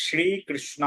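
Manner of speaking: শ্রী with a palatal "sh" and long ee, "shree"; correct Sanskrit pronunciation. Shown as a demonstration. Teacher demonstrates for learners, correct Sanskrit pronunciation. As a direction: draw out "shree" in শ্রীকৃষ্ণ, tongue to the palate. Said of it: "এর উচ্চারণ আসবে".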